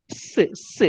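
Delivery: "s" as a soft unvoiced hiss; snake-like s. Shown as a demonstration. Teacher shows how to learn "s", snake-like hiss. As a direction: say "s" like a snake, only breath. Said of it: "You can learn like a snake".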